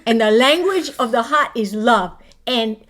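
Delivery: Hawaiian Pidgin, animated and loud; Pidgin accent. Pretend. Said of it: "say something in Pidgin".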